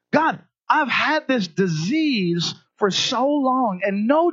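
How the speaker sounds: high, drawn-out cry to God; pleading lament. Acted. Struggling believer prays to God for answers, pleading. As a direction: plead slowly and high, worn down by waiting. Acting out a struggling person's prayer.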